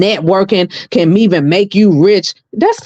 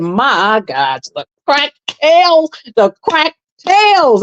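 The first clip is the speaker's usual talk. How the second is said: mock shock; 5 semitones higher, slower than usual. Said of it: "I said".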